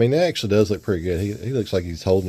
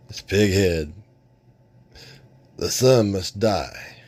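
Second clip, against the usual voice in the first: slow, dark pronouncement; ominous villain; slower than usual. Acted. Pighead declaring his plan, slow ominous voice. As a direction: drag out the doom.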